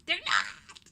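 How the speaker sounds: sharp loud denial; indignant retort. Real, not acted. Arguing with the absent roommate, loud and offended. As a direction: snap back, offended. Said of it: "I need to calm down".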